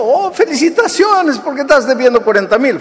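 high, quick ironic cheer; sarcastic mock congratulations. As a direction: pretend to congratulate, dripping irony.

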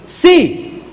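ordinary delivery

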